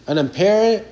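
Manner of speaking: ordinary delivery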